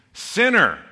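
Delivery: a sudden high, loud verdict; mock pronouncement. Preacher to the congregation, unveiling the label with high, loud scorn. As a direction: announce the label like a damning verdict.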